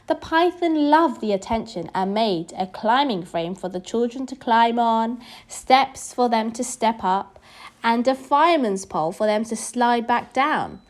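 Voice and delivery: ordinary delivery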